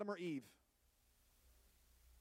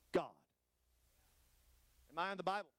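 ordinary talk, nothing marked

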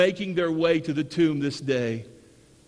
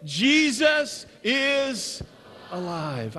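drawn-out, higher proclamation of conviction; like you really believe it; 3 semitones higher, much slower than usual. Shown as a demonstration. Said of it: "Now say it like you really believe it".

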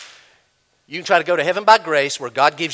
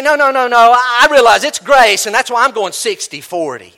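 defensive believer; 5 semitones higher, 8 dB louder than usual. Retold or imitated. Preacher voices a defensive believer.

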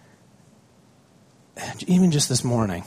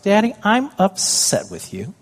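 put-on higher, slow little girl complaint; upset daughter; 9 semitones higher, 3 dB louder than usual. Daughter telling daddy she's upset, high and slow. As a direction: pout it out like a hurt child. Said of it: "and she's like".

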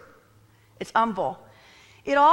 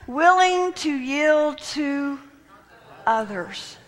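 very slow verse reading, raised at first; drawn out; 4 semitones higher, 3 dB louder, much slower than usual. Shown as a demonstration. Preacher repeating the key verse, drawn out. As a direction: stretch it out, dropping on OTHERS.

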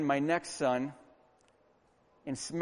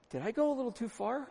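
soft, higher, quick little question; sheepish mock doubt; 7 semitones higher, 6 dB quieter, faster than usual. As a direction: wonder it meekly, played for laughs. Mock doubt after scaring son.

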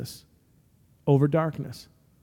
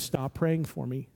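quiet warning; 2 semitones lower, 9 dB quieter than usual. Retold or imitated. Russ leaning over to warn the narrator, quiet and slow. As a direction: murmur it under the prayers, slowly.

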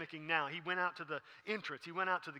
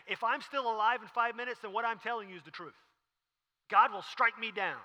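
raised, loud sworn declaration; emphatic oath; 5 semitones higher, 8 dB louder than usual. Acted. Peter insists to accusers he is truthful, loud emphatic oath. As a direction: stake your life on it, forcefully. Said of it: "he's basically saying".

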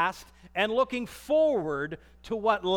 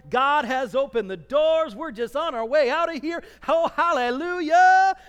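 loud, higher, happy shout ending in hallelujah; joyful exodus; 4 semitones higher, 7 dB louder than usual. Acted. Israelites leaving Egypt in high spirits, joyful shout. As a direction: rejoice as if the doors just opened.

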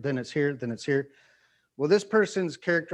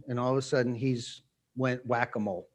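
ordinary talk, nothing marked

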